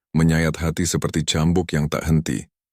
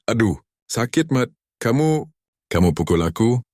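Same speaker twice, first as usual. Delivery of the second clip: high shocked cry of pain; 8 semitones higher than usual. A narrator's reading. Wife hurt by husband, crying out. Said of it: "Siti menjerit".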